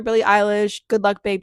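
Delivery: normal talking voice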